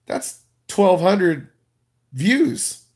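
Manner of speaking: slow it right down and lift "views" up high; mock-impressed, spelling out "twelve hundred views"; slow emphasis. Real, not acted. Punchline about tiny earnings.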